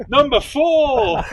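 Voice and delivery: put-on high, loud, slow drunken cup-draw announcement; over-the-top [name]. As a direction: stretch it out like a tipsy showman. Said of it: "It's just really over the top".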